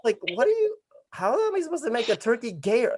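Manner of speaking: very high-pitched baffled question; squeaky retold bewilderment; incredulous. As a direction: protest in mock disbelief. Acting own baffled reaction to note.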